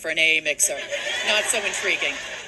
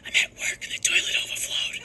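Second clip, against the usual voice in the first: unvoiced mom whispering a confession; 3 dB quieter than usual. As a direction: hush it so coworkers won't hear. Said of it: "Why are you whispering?".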